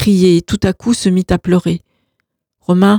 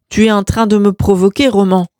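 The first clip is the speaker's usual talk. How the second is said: ordinary delivery, nothing marked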